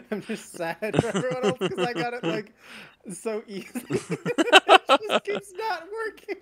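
laughing hard while talking; high, loud giggling through words. Real, not acted.

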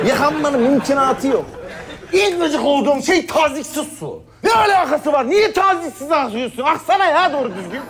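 indignant shout at a weak shower; furious rant. Acted. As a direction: rage at the trickling shower.

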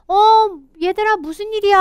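curious animal voice. Acted. Teacher voicing the back animal to the children, high and surprised. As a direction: call to the kids like a puzzled animal.

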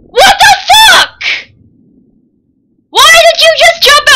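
high, loud disbelieving yell; outraged shout. Real, not acted. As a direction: yell at the screen in shock. Character jumps from boat.

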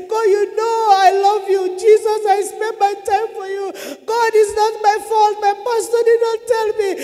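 high, loud wailing plea; desperate tearful pleading. Retold or imitated. Sufferer begging God for mercy in torment, crying out desperately. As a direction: beg urgently, close to sobbing.

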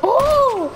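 very high, drawn-out "oh"; excited whoop. Real, not acted. Player reacting to his no-scope, excited whoop. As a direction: whoop at a lucky shot.

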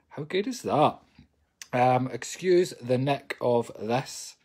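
ordinary delivery

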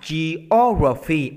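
"geography" with a long, strong O; slow "geography", punching the second syllable; stress on second syllable. Shown as a demonstration. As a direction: lean hard on the O in "geography". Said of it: "mình sẽ nhấn âm hai".

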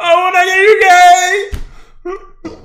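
drawn-out, high yell; exaggerated slow yell. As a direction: stretch every word and holler. Mock yell in English.

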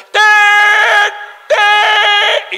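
high, loud sustained yell; stuttered shout. Acted. Stutterer standing up at sea, shouting blocked syllable. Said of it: "se pone el tipo de pie y empieza".

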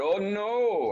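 high, drawn-out concern; concerned crab protesting; mock-worried crab. A narrator's reading. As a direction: swoop up in storybook dismay. Crab worried for his friend.

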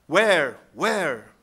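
loud quoted cry. A narrator's reading. Damrod calls out in Ithilien, crying out. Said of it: "cried Damrod".

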